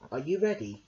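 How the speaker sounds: ordinary delivery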